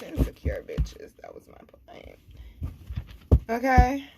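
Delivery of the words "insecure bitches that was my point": hushed whisper; sly whispered repeat; whispered aside. Real, not acted. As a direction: whisper it like a secret dig.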